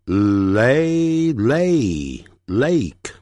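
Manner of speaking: slow phonics blend up to lake; sounding out. Shown as a demonstration. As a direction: Lay with a stretched L, building to lake. Teacher builds word from sounds.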